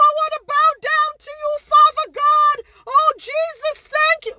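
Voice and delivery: very high, slow, loud churchy adoration; mock gushing praise. Mocking how fans fawn over others, in high slow rapture. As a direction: ham up a swooning worshipper, shouting hallelujah. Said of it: "When everybody else talk".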